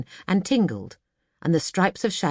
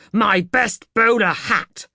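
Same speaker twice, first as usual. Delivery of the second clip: slow, louder, indignant wail; furious; 5 semitones higher, 6 dB louder, slower than usual. Acted. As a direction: drag out the outrage. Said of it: "he said between his teeth".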